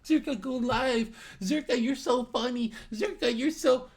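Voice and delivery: high-pitched imitation of a gushing fan; mock needy fan. Streamer mocks viewers begging him to go live, mock needy fan. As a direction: put on a high, pleading fanboy voice. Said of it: "You won't say it like a".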